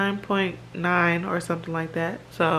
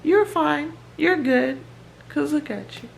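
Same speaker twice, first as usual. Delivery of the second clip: high-pitched mock parent brushing it off; dismissive parent; 8 semitones higher than usual. Parents telling a feverish kid he's fine, high and dismissive. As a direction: play the parent waving off a sick kid, pitched up. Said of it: "I understand when parents be like".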